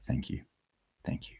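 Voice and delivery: hushed, soft thank-you, almost a whisper; murmured gratitude to a kind commenter. Real, not acted. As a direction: breathe the thanks out quietly. Touched by viewer's kind comment.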